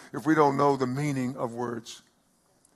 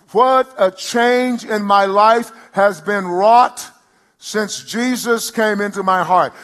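slower, loud, high testimony; declaimed hymn line; 5 semitones higher, 7 dB louder, slower than usual. Acted. Pastor repeating the hymn line for the church, slower and loud. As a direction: stretch it even wider.

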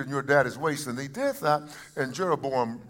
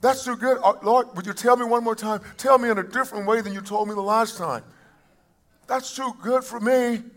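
higher-pitched, acted plea to God; raised voice of someone who can't accept praise; overwhelmed disbelief; 8 semitones higher than usual. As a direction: act out humbly asking to hear it repeated. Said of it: "I'm saying".

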